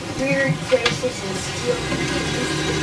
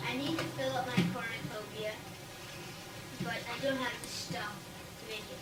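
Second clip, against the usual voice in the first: quiet witch voice; 12 dB quieter, faster than usual. Acted. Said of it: "Mary the Witch said".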